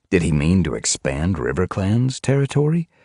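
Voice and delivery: ordinary delivery, nothing marked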